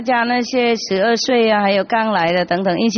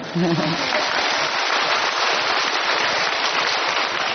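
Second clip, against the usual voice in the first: soft breathy laugh; 5 semitones lower, 5 dB quieter than usual. Real, not acted. Thanking the child.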